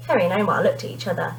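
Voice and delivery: usual speaking voice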